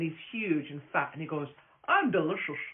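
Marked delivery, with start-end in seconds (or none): 1.9-2.6 light imitation of a big Irish guy in an Irish accent; loud, playful Irish accent, lightly done. Imitating the Irish food guy in an Irish accent. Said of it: "But in an Irish accent".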